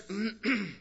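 rough throat clear before resuming. Real, not acted. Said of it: "I'm losing my voice".

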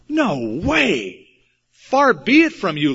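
acted disbelief, emphatic and rising; mock indignant protest. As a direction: imitate an appalled refusal, pitch swooping up. Peter's acted protest at Jesus dying.

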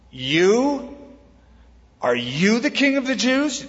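"You" pitched high in an incredulous rising question; stress on YOU. Shown as a demonstration. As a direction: lean hard on "You", lifting the pitch as if questioning it. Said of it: "there's an emphasis on the word you".

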